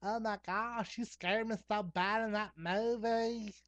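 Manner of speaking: high, loud, drawn-out gushing impression; hammed-up high-pitched girlish exclaiming. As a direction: squeal it slowly like a mock starlet. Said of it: "and [name]'s like".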